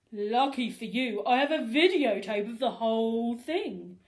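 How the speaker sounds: a smug principal laying a trap. Acted. Principal confronting the boys, smug and knowing. Krupp voiced.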